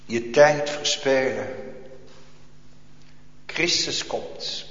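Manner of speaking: usual speaking voice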